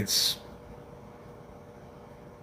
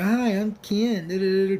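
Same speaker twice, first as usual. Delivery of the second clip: mock Ken greeting; 5 semitones higher than usual. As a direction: lightly mimic a man introducing himself at a bar. Mocking Ken chatting in background.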